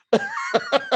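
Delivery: high bursting laugh of disbelief; real laugh. Real, not acted. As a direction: laugh out at how crazy it all was. Laughing at the remarkable coincidence.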